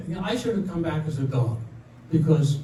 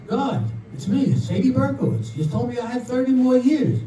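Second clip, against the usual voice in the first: higher, lightly acted complaint; indignant protest; 6 semitones higher than usual. Woman killed after surgery complains to God, indignant. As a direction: plead your case, pitch lifted. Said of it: "and she says".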